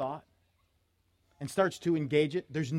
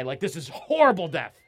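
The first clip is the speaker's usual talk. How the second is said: usual speaking voice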